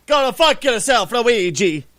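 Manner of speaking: loud, badly faked Italian accent; terrible Italian accent. Telling Luigi off as Mario, in a terrible Italian accent. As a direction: do the worst Italian accent you can, loudly. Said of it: "That was the worst Italian accent".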